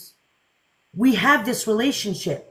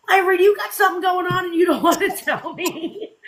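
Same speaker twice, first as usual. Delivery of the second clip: higher, laughing through the tease; teasing laugh; 6 semitones higher than usual. Retold or imitated. Cohost teasing Ivory about a secret, laughing. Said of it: "'cause she's going".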